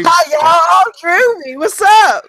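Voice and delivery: hammed-up high greeting, playing your co-host; Drewby impression. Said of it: "this impersonation of me".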